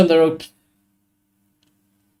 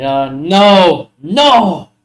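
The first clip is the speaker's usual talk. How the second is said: exasperated; 3 semitones higher, 4 dB louder, much slower than usual. Real, not acted. Hiccup fit, exasperated.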